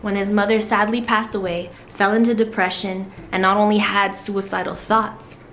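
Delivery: bright, upbeat TV presenter; talk show host. Acted. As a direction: sell it like a daytime host.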